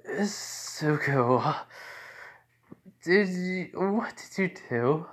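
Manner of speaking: slow, drawn-out dread; acted-out slow suspicion; wary questioning. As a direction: draw out the name, then ask haltingly. Wary questioning of what he did.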